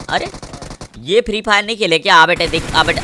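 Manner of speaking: ordinary talk, nothing marked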